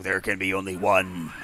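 low, solemn Highlander-style quote; movie-line impression. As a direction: drop low for a mock-epic movie line. Quoting a film's famous line.

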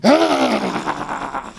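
long, high-pitched angry yell; angry roar. Acted. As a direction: let out a drawn-out cry of rage. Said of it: "burning with anger".

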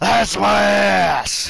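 loud, high, drawn-out mock shout; mimicked shout. Echoing the video's line for laughs, mimicked shout. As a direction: holler it back, stretching it way out.

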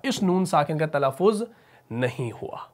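ordinary talk

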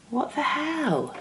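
high, slow shocked exclaim; sudden dismay at the leak; surprised. Real, not acted. Product leaking, annoyed.

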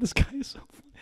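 breathy delighted whisper; whispered aside to co-host. Real, not acted.

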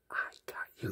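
barely audible imitated whisper; mouthed whisper. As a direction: mouth it secretly, almost without sound. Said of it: "Like, she mouthed".

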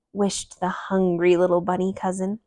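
usual speaking voice

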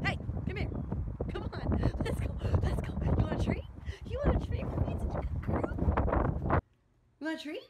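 high sing-song dog talk. Real, not acted. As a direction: coax a dog with a treat. Talking to her dog.

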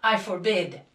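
loud, forceful forbidding; shouted command. A narrator's reading. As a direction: shout it down over everyone. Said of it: "he shouted".

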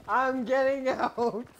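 high, slow, laughing through the words; delighted escape giggles; giddy laughing. Real, not acted.

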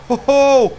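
very loud, high whoops; excited yelling. Real, not acted. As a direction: whoop at the crash. Crashing into a water barrel.

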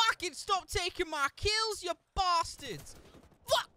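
outraged gamer yelling at kill-stealers; furious shout. Real, not acted. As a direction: scream it out, slowly dragging the words. Raging at teammates stealing kills.

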